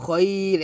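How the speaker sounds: "খয়র" stretched, closing on r; stopping on "khoir". Shown as a demonstration. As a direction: say "খয়র" as "khoiiir", no vowel after it. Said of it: "এভাবে আমরা এখানে ওয়াকফ ধরবো".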